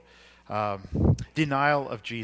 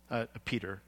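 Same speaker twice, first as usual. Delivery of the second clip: usual speaking voice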